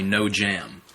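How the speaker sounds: normal talking voice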